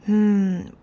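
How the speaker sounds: ordinary talk, nothing marked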